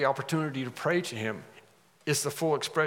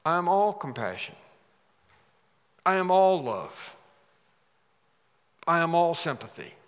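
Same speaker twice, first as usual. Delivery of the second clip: reassuring Jesus; 4 semitones higher, 4 dB louder than usual. Acted. Jesus' imagined reply.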